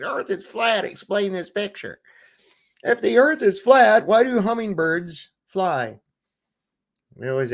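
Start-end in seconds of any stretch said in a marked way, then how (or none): 0.0-6.0 high, mocking singsong; mocking globers. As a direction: parody the globers' taunts. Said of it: "Globers be like".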